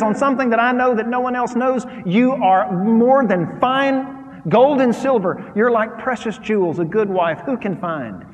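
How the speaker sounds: loud, lifted reading of the verse; preaching scripture. Acted. Preaching the husband's praise.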